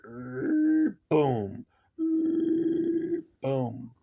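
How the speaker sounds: high mechanical whirr then a dull boom; imitating walker leg noises. For viewers showing the toy's leg sounds, mock mechanical stomps. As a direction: mimic the toy's stomping legs. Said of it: "this one here makes the leg noises".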